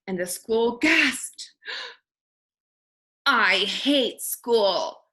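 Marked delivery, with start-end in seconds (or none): 3.2-5.1 slow sulky grumble; mock sulky kid. A narrator's reading. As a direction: drag it out like a bored kid. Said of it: "said another".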